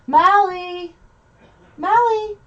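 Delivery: high calling out of a name; calling the dog. Real, not acted. Streamer calls her jealous dog over, high call. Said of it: "that's why I'm yelling at the dog".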